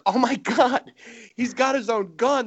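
high-pitched mock astonishment; amazed troops. Troops seeing Nugent pull his own pistol, amazed. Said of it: "and the troops are like".

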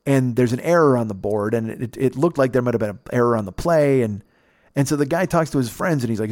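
ordinary talk

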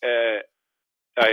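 stunned, broken stammer; speechless. Retold or imitated. Player hears the contract figure on the phone, speechless. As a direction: struggle to get a word out. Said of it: "I couldn't speak".

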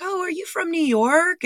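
high-pitched mock chatty stranger; put-on high voice for an intrusive admirer; mimicked pickup line. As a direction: imitate a chirpy guy striking up conversation. Quoting the man hitting on her.

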